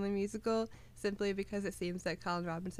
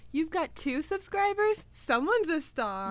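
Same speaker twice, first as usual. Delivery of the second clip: much higher, gushing praise, slow on the star; proud parent voice; 7 semitones higher, 6 dB louder, slower than usual. Retold or imitated. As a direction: hype him up like a doting mom. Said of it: "Guillermo was like".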